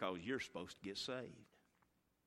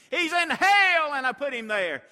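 sobbing scream, higher and louder; mother's collapsing scream, much louder; 19 dB louder than usual. Acted. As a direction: cry it out as she collapses. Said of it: "collapsed to the ground screaming".

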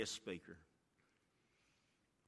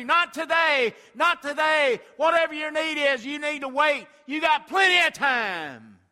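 loud shouted demon voice, higher; demons' taunt; 20 dB louder than usual. Acted. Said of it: "these demons ... telling you".

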